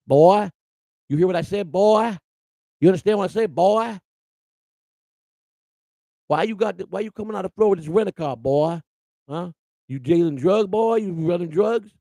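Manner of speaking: bully him like a backroad deputy; Southern sheriff. Retold or imitated. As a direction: sheriff grilling a Yankee driver, mock Southern accent. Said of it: "That's how they talk to you".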